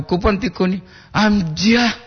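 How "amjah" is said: high-pitched, mock-disappointed "Amjah"; raised voice feigning disappointment; disappointed exclamation. Shown as a demonstration. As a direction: attempt a let-down cry, pitched up high. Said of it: "intonasi yang penuh kekecewaan".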